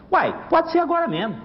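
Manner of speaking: put-on high, eager old rooster voice; old rooster setting the race for right now, high and eager. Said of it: "Falou assim".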